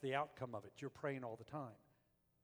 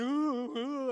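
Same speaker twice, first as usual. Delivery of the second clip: put-on whining kids; 9 semitones higher, 8 dB louder than usual. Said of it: "a whiner kid".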